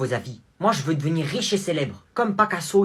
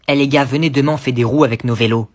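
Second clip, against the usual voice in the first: appel aux potes; 9 dB louder, faster than usual. Acted. Copains qui s'appellent dehors.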